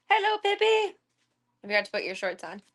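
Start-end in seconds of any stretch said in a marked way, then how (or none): 0.1-0.9 much higher and louder squeaky greeting; high squeaky pet greeting; baby talk. Pretend. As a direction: coo at the little dog.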